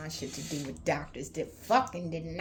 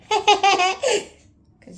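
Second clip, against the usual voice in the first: loud, slow, through laughter; laughing; 14 dB louder than usual. Real, not acted. Joking about drinking back then, laughing. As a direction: laugh it out at yourself.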